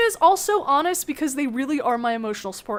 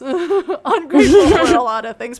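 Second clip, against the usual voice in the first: laughing; 7 dB louder than usual. Acted. Joke variant with laugh.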